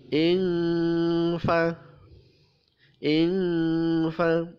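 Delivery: ingfa with the n hidden in a nasal ng; ikhfa nasal. Shown as a demonstration. Tajwid teacher showing hidden n in ingfa. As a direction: blur the n of ingfa into ng. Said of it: "membacanya samar".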